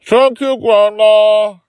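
muffled high voice from inside a hug. A narrator's reading. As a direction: speak muffled as if pressed into a hug. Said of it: "in a muffled voice from the inside".

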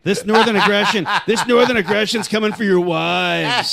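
loud, laughing battle cry; mock threat. Northerner taunting Southern men about wives, loud mock threat. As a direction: boom out a hammed-up taunt.

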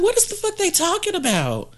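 high, baffled disbelief; baffled retort. Retold or imitated. Retelling a stranger's compliment, high baffled disbelief. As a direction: squeal it out in confusion. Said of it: "I was just like".

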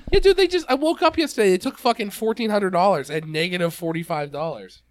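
high, loud indignant burst; excited outrage. Real, not acted. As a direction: rant it in disbelief. Outraged about bank taking money.

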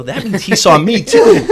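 loud, high, slow, laughing excitement; retold giddy thrill. Fan realizing the Boss saw him, acted giddy. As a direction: crow it with a laugh, stretching it out.

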